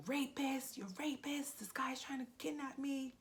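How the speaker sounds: high frantic cry for help; retold screaming. Screaming high to neighbors for help against the attacker. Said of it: "I started screaming".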